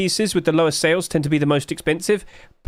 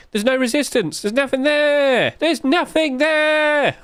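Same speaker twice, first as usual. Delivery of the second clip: higher and slower, building up; excited; 10 semitones higher, slower than usual. Real, not acted. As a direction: stretch it out in awe. Excited about rising price.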